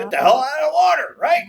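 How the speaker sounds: high loud urgent yell; loud shout. Acted. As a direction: yell it like fleeing a shark. Urging escape from a shark.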